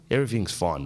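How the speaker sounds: ordinary talk, nothing marked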